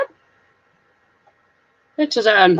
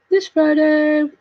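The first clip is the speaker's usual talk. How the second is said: drawn out, higher singsong; playful long-held repeat; playful echo; 6 semitones higher, slower than usual. Pretend. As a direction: stretch it out in a high announcing lilt.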